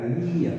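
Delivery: Lía with a long, stressed í, Lí-a; Lía with the í held long and stressed. Shown as a demonstration. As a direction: stretch the í in Lía. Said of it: "¿Veis que yo la pronuncio más larga?".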